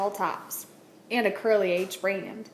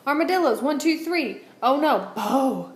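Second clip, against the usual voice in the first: worried mama counting; 5 semitones higher, 4 dB louder than usual. A narrator's reading. Mama counts, finds Bo gone.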